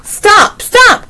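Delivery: high, urgent storybook cry. A narrator's reading. Annie begs villagers to stop stoning ravens, crying out. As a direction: cry out to make them stop, pitch shooting up. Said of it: "cried Annie".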